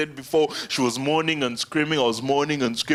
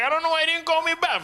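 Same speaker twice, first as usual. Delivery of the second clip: mock girl's whine, very high; high, fast put-on girl voice; 13 semitones higher, faster than usual.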